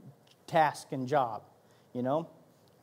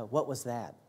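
God's gentle question; 8 semitones lower, 9 dB quieter than usual. Retold or imitated. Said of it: "he was like".

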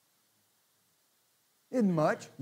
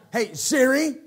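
impatient call; 7 semitones higher, 9 dB louder, slower than usual. Retold or imitated. Getting upset at device.

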